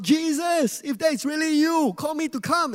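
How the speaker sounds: high, loud call across the water; shouted plea. Retold or imitated. Peter on the boat calling to Jesus, shouting. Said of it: "Peter shouted out".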